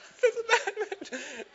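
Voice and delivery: very high, slow, laughing; laughing squeak. Retold or imitated. A teen squeaks back at the hard cop, laughing. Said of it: "I was like".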